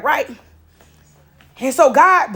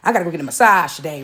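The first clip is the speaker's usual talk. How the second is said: lower, fast, breezy self-imitation; self-mock pampered voice; 7 semitones lower, faster than usual. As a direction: brag lightly about your day off. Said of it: "this is how I am".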